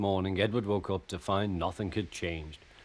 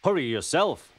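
higher-pitched snappy comeback; cheeky retort; 7 semitones higher, 4 dB louder than usual. A narrator's reading. Thomas answers waiting Gordon back, cheeky retort. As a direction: throw it straight back, raised in pitch. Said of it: "replied Thomas".

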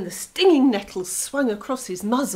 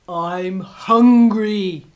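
slow, loud, growling wolf; hungry wolf; 2 semitones lower, 10 dB louder, much slower than usual. Acted. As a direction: drawl it out like a big hungry wolf. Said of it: "he said, and he grinned".

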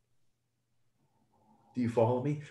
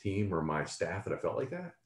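embarrassed; 5 semitones lower, 5 dB quieter than usual. Retold or imitated. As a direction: lower and softer self-doubting aside. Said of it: "I'm like".

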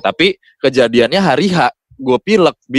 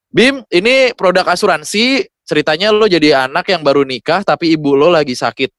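client giving a brief; 7 semitones higher, 5 dB louder than usual. Retold or imitated.

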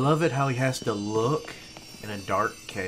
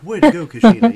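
mock-patronizing, raised and louder; patronizing praise; 12 semitones higher, 18 dB louder, faster than usual. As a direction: imitate someone condescendingly cheering you on. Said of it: "I feel like he's patronizing me".

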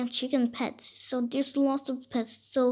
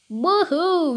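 cheer; 6 semitones higher, 5 dB louder than usual. Real, not acted. Cheering during toy play.

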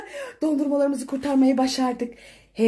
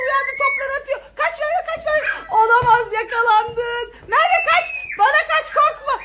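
excited laughing shouts; 8 semitones higher than usual. Real, not acted. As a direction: call her back to safety. Dad throws balls, chase panic. (gülerek heyecanlı bağırış)

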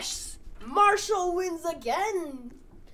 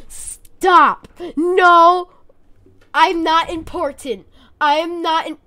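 insistent, raised-voice objection; loud protest; 3 semitones higher, 10 dB louder, slower than usual. Real, not acted. Player being voted into a fight, loud protest. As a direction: shout down the people picking you.

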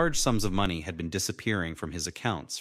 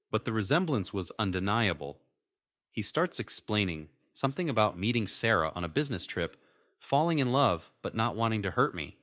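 ordinary delivery, nothing marked

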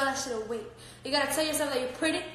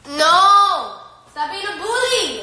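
loud, pitched-up telling-off; loud scolding; 5 semitones higher, 10 dB louder, slower than usual. Real, not acted. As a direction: yell at the dog to stop. Scolding the dog.

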